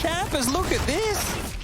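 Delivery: high, slow hype call; real excited play-by-play yell. Real, not acted. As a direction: shout it up high as the play unfolds. Caster hypes a big play.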